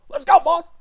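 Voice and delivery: loud, high bar-guy holler; rally the boys like a drunk; rowdy hype yell. Acted. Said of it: "You sound like some drunk ass motherfucker at a bar".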